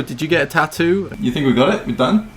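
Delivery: ordinary delivery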